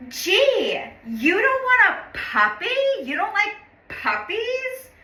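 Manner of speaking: high-pitched mocking Valley Girl, questions swooping up; sugary mock disbelief in Valley Girl uptalk. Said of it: "this Valley Girl voice".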